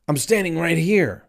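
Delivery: high-pitched exasperated protest. Retold or imitated. Customer at the counter answers the announcement, exasperated. Exasperated reply to the order call.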